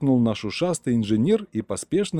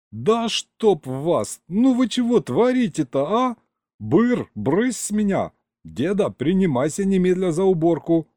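exasperated shouting; 8 semitones higher than usual. Acted. Hero yells at grandpa and dragon.

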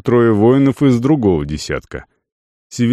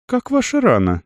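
concerned; 12 semitones higher than usual. A narrator's reading. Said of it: "неожиданно озаботился купец".

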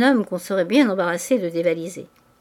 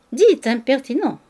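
mock indignation; 5 semitones higher, 6 dB louder than usual. A narrator's reading. Playful quarrel during an embrace.

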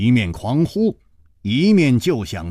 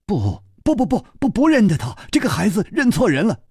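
higher, rushed and flustered; panicked denial; 10 semitones higher, faster than usual. Acted. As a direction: deny it in a frantic rush. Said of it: "两个人抢着说". (薛斌和左姑娘慌忙抢着否认，急促慌张)

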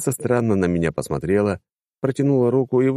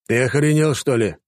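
indignant, raised outburst; flaring up; 7 semitones higher, 3 dB louder than usual. Acted. Ex-ninja flaring up at the clown, indignant. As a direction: snap back in outrage. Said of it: "тут же взвился бывший ниндзя".

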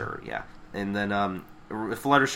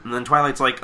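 ordinary talk

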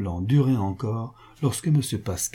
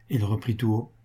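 ordinary delivery

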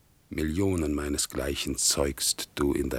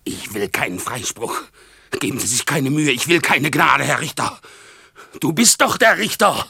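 agitated, mounting defiance; defiant outburst. Acted. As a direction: refuse the judge, building to a shout. Delirious man defies imagined judge.

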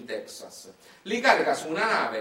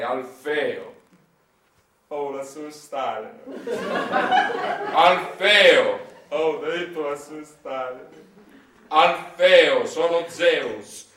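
slow, loud, god-like booming call from above; booming Zeus; 5 dB louder, much slower than usual. Acted. Zeus calls down to Alfeo, slow and booming. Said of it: "Zeus l'assoluto volle intervenire".

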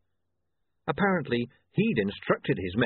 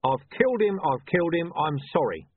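louder, higher cry of I've killed him; quoted confession; 6 semitones higher, 3 dB louder than usual. A narrator's reading. Vimes reading Vetinari's words from his notebook, raised and alarmed. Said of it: "Vimes consulted his own notebook".

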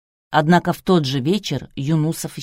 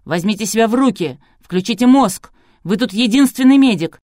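loud, forceful scolding; attacking; 4 semitones higher, 6 dB louder than usual. A narrator's reading. Producer lays into the only doctor present, sharply. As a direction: go at him with urgent impatience. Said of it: "набросился на него".

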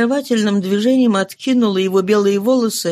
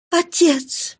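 higher emotional cry; 8 semitones higher than usual. Acted. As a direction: cry out to him. Cosette cries out to father.

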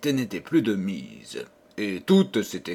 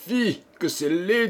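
higher, shaming the tiger; indignant scolding; 7 semitones higher than usual. Acted. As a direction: scold the tiger like a naughty child. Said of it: "dans son indignation".

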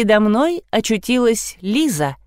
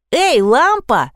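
higher, drawn-out call; yelling girl; 6 semitones higher, 4 dB louder, slower than usual. A narrator's reading. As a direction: holler slowly for someone in another room. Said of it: "завопила Лиза". (вопит, протяжно зовёт)

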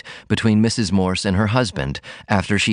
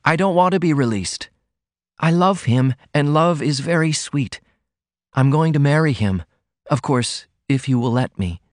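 put-on higher girlish voice, tender and firm; devoted Ruth; 7 semitones higher than usual. A narrator's reading. Daughter defending her love, high girlish voice. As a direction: insist sweetly on her love. Ruth's voice held.

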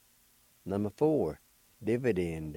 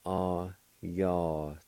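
Ayatollah drawn out very slowly; very slow demo; much slower than usual. Shown as a demonstration. Teacher slowing the word for learners. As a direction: stretch every syllable of Ayatollah. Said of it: "It's pronounced like this".

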